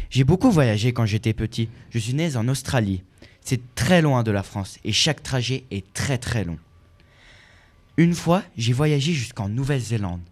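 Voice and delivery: normal talking voice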